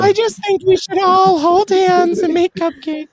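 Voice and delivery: put-on high, sugary girly voice; Mean Girls quote. Quoting Mean Girls to the group, high sugary voice. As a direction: gush it like a sweet schoolgirl. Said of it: "That line from Mean Girls".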